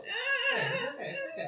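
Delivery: put-on soothing child. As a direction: coo it gently to a scared child. Player soothing crying child character.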